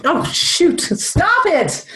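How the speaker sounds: high, startled yelp of annoyance; startled outburst. Real, not acted. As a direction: cry out at a sudden interruption. Smoke alarm beeps mid-reading.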